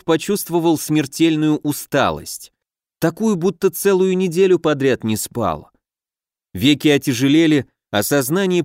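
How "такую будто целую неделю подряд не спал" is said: ordinary delivery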